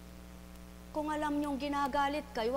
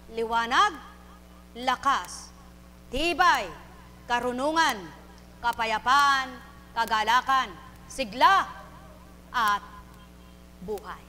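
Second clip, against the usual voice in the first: emphatic preaching list; 3 semitones lower, 5 dB louder, slower than usual. Real, not acted. Preacher listing gifts.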